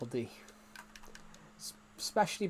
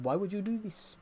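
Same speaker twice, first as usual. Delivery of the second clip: a lightly voiced film character, pitched up; mock the film line in a slightly higher put-on voice; quoting a film character; 6 semitones higher than usual. Streamer quoting a silly student film line, lightly put-on voice. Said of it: "one of them just said".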